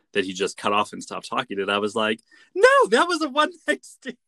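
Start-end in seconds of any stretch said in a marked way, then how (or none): 2.5-4.2 high, loud exclaimed objection; mock outraged protest. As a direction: blurt a squeaky, exaggerated correction. Acted disbelieving retort to podcast.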